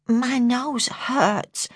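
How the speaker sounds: put-on high, slow complaining little-girl voice; whining child, muffled. A narrator's reading. Jodie complaining to her carer about her nose, high muffled whine. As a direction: whine it slowly, as if behind a hand. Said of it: "her voice muffled by her hand".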